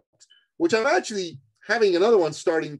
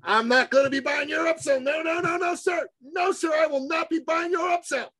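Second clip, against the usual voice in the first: high, strained, comically insistent voice; adamant refusal; 14 semitones higher than usual. Retold or imitated. Attendee telling host he won't buy, adamantly. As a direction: caricature a man firmly refusing.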